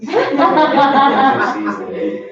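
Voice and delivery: confession that trails off, then a slow, high sing-song on the last grades; a kid hoping the bad grades slip past, drawn out high; sheepish kid confession. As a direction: son owning up to his dad about his grades, drawn out and high. Son confessing grades to dad.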